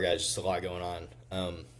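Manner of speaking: normal talking voice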